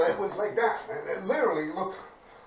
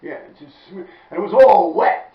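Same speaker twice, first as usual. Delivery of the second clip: ordinary delivery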